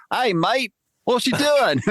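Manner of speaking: high, loud mock Australian greeting; Australian accent. Giant lizard aliens stepping off ship, mock Australian accent. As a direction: play a cheery Aussie lizard alien. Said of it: "speak in Australian accents".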